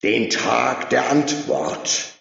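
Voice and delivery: slow, loud, high proclamation; proclaiming. A narrator's reading. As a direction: let it ring out slowly. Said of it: "rief der Oberjubler".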